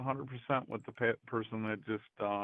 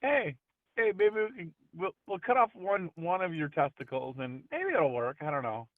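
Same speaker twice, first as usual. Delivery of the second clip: a hammed-up chirpy surgeon, much higher; mock eager doctor; 7 semitones higher than usual. Friend mocking the doctor who suggested surgery, hammed-up high eager pitch. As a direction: sell absurd surgery like a cheerful salesman.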